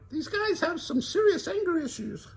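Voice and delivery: high, wry Velma; dry quip. Acted. Velma voiced.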